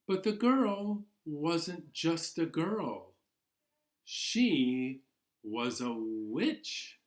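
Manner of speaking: gentle storybook narration, unhurried; reading to children. Acted. Teacher reading Ghosts in the House to class, storybook voice. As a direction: draw the little ones into the tale. Reads picture book to children.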